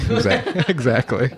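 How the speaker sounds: breaking into laughter mid-word; laughing. Real, not acted. Laughs while talking.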